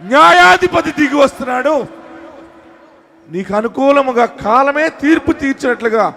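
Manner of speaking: high, loud proclamation; fervent sermon climax; full-force preaching. Real, not acted. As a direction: declare it to the whole congregation like a herald.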